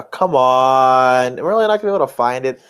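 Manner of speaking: ordinary talk, nothing marked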